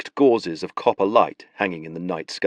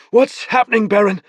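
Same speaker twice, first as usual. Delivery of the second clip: anxious call; 13 semitones higher, 6 dB louder than usual. Acted. Tristan rowing, confused.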